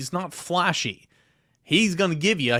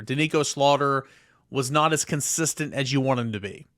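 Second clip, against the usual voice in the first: usual speaking voice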